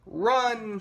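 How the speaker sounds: high, stretched call to flee; urgent cry. A narrator's reading. First pig warning brother of zombies, urgent cry.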